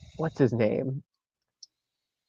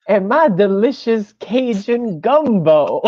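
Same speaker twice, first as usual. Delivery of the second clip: slow, higher, put-on Hutt drawl; Zero the Hutt voice; 7 semitones higher, 4 dB louder than usual. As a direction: drawl it like a sleepy Southern Hutt. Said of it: "Zero the Hutt says".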